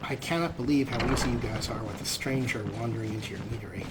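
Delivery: ordinary talk, nothing marked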